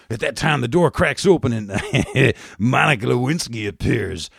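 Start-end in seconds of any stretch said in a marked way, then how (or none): none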